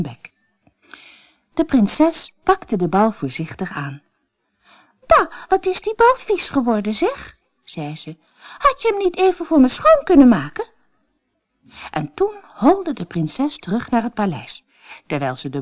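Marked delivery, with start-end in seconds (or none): 5.1-10.7 high, fast, spoiled complaint; disgusted princess. Acted. Princess grumbles about the dirty ball, high and spoiled.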